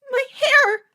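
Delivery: very high, loud shriek; hysterical, piercing cry; screamed. A narrator's reading. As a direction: scream in horror at the loss. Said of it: "Hannah screamed".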